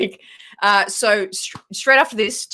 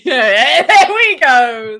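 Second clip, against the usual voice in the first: loud laughing cheer; laughing, louder; laughing loudly; 6 semitones higher, 11 dB louder, much slower than usual. Real, not acted. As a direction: burst out laughing as you cheer. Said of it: "nailed it".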